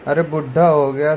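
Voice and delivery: ordinary talk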